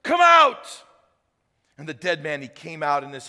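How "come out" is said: loud, high, slow call; commanding shout. A narrator's reading. Jesus calling the dead Lazarus out, in a loud commanding shout. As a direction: shout it into the tomb. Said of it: "Then Jesus shouted".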